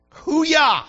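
loud high hoo-yah whoop; a preacher's triumphant whoop; whooping shout. Real, not acted. Preacher's exclamation.

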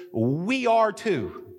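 ordinary talk, nothing marked

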